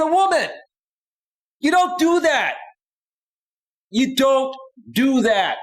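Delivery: high-pitched, exasperated insistence; emphatic warning. Real, not acted. Streamer warning guys whom not to ask, emphatic. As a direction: drive the rule home, pitched well up.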